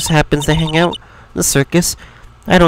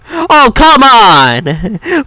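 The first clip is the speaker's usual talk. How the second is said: exasperated; 8 semitones higher, 4 dB louder, much slower than usual. Acted. Barred from lodging house, protest.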